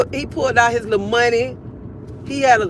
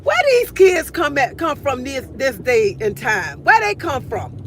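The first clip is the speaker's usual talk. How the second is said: exasperated exclaim; 4 semitones higher than usual. Retold or imitated. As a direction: marvel at these kids.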